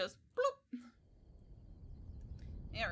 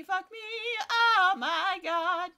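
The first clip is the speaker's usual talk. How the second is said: much higher, loud and drawn out; high panicked shriek; 10 semitones higher, 9 dB louder, slower than usual. Real, not acted. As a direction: squeal in alarm as you barely survive. Panicking at enemy attack.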